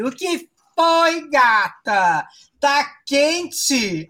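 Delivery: high-pitched, drawn-out mock concern; drag rival fingindo surpresa. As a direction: play the catty rival, hammed up and slow. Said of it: "a inimiga fala assim". (rival debochada, com voz aguda e arrastada)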